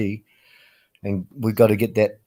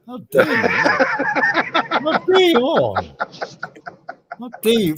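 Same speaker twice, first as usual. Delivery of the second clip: high outraged cry, drawn out; mock outrage; slower than usual. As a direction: shriek it in offended disbelief.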